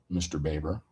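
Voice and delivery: normal talking voice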